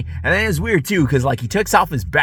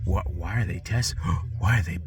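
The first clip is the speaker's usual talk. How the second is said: soft, panicky inner voice; quietly imitating his own alarm; hushed alarmed self-quote; 7 dB quieter than usual. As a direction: mutter your own startled thoughts. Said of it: "and I'm like".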